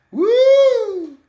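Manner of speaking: loud, high sustained "woo"; preacher's loud falsetto whoop. Real, not acted. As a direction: let out a long high whoop. Whoop after the car horn point.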